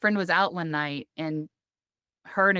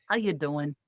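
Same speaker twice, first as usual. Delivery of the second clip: imitating a Midwestern accent; 2 semitones lower than usual. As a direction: copy his Midwestern "How you doing?". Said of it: "a little bit of a Midwestern accent".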